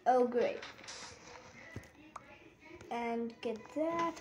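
normal talking voice

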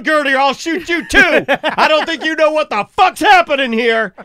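high, loud barked threats; mock furious gunman. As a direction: yell orders as if controlling hostages. Acted gunman yelling at clerk.

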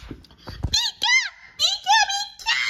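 very high creature squeak; piercing high little-creature cry; ear-splitting squeal. Pretend. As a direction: squeal it at the top of your range. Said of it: "Ow, my ear!".

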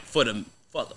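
ordinary delivery

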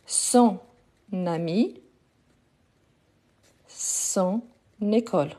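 each word alone, with "ami" and "école" begun on n; "ton... nami, ton... nécole", long pauses between words; separated liaison. Shown as a demonstration. Teacher dictating examples, word by word with the linking n. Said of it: "de la même façon".

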